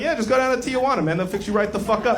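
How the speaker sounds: breezy fast advice; casual. Retold or imitated. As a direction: toss it off casually. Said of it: "They say it so casually".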